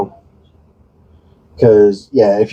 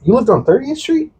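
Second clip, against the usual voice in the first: surprised question. Retold or imitated. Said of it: "I'm like".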